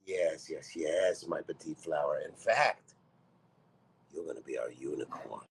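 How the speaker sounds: usual speaking voice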